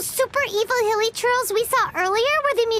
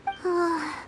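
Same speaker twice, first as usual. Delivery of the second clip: quiet, sorrowful sigh; soft sigh; 3 semitones lower, 5 dB quieter than usual. Real, not acted. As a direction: let out a soft sad breath. Reacting to tragic book passage.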